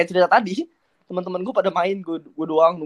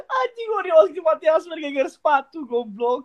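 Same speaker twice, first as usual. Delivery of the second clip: laughing protest; 8 semitones higher, 3 dB louder than usual. Real, not acted. Mock outrage at being teased.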